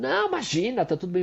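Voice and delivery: high, breezy brush-off; sister brushing it off. Retold or imitated. Sister brushing off the apology, light and high. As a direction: wave it away lightly, a hint of the sister. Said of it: "E ela fala".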